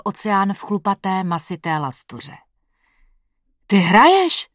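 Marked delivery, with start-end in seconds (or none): 3.7-4.5 sudden louder startled question; blurted out. Acted. Boyfriend startled by a voice from his girlfriend's body, blurting. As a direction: blurt it out in shock. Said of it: "vyhrkl jsem".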